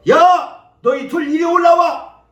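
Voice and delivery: high, loud shout to men below; guard hollering an order; calling down commandingly. Acted. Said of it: "그렇게 얘기하는 거예요".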